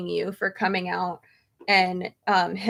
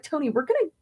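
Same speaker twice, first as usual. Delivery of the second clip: excited plan; 5 semitones higher, 7 dB louder than usual. Retold or imitated. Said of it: "It's like".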